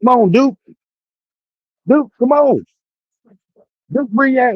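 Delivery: high-pitched call to a viewer in chat. Real, not acted. Calling viewer Duke up.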